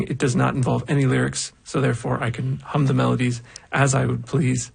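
normal talking voice

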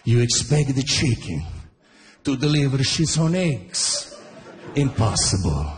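dad lecturing his son with grave certainty; Italian dad explaining. Retold or imitated. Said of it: "It's like".

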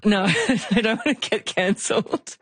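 high, laughing refusal. Real, not acted. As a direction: laugh the joke away. Laughs off Italian accent request.

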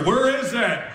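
high, frantic sleep-talker voice. Acted. Wife asleep rooting through the bed, frantic. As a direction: demand it high, searching frantically. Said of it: "now she's rooting through the bed, looking for".

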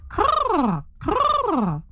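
high falling crow caw; a raven calling out; raven croak. Acted. Raven's call.

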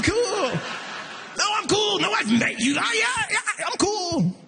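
high, shouty flustered reassurance; hammed-up panicky I'm cool; nervous date. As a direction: try way too hard to seem cool.

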